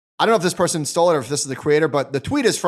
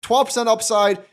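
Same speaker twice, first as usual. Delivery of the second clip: lightly voiced investor, pitched higher, picking options; imagined eager investor; 7 semitones higher than usual. Investor browsing buffer ETF choices, voiced light and higher. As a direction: play a buyer shopping for outcomes, a bit higher.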